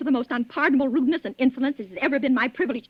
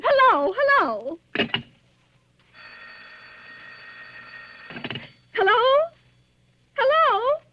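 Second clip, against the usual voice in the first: anxious calling; 11 semitones higher, slower than usual. Acted. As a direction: call out, hoping someone answers. Silent caller on the line.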